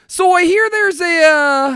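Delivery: a hint of the frontman addressing the crowd. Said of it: "That's how he sounds".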